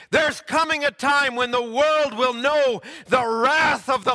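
high, loud, impassioned sermon voice; fiery preaching. Acted. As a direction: build to a thundering warning. Preaches at full force.

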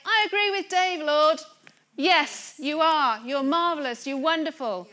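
ringing, emphatic declaration of worship; loud praise. Acted. Preacher blessing God in agreement with a friend, loud praise.

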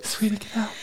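high unvoiced whisper, soft and urgent; whispered warning. Husband whispering to his wife that the house is on fire, hushed and high. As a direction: breathe the warning, never raising the voice.

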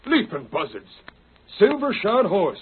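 it's dawning on you what horse this is; breathless outlaw discovering a prize; excited realisation. Acted.

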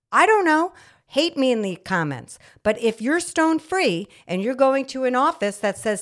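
ordinary talk

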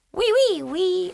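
high, silly cartoon French pea; squeaky fake French "wee wee"; French accent. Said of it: "I can't do a French accent".

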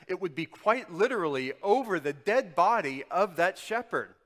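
loud, driving preacher's cry; a fiery sermon climax; full-force preaching. Acted. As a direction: preach it hard to the whole room.

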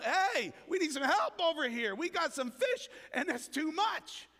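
excited fisherman yelling for help; retold yell. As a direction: call out for help across the water. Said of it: "he's yelling".

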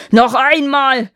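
slow, loud, high giant bellow; roaring grunting brute. Acted. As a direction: roar it like an enraged giant. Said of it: "brüllte der Riese".

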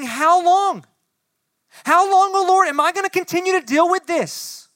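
loud, high anguished plea; desperate cry. Acted. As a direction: cry out to God, at full force. Voicing David's cry again.

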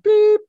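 high loud beep; high, loud bleep noise; mock censor bleep. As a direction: imitate the bleep.

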